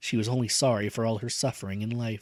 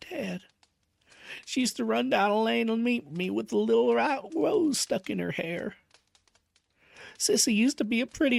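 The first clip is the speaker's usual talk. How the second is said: higher, strained voice of a grieving father; grieving old father; 12 semitones higher than usual. A narrator's reading. Roaring Abel mourning Sissy to Valancy, grieving. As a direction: remember her sadly, close to breaking.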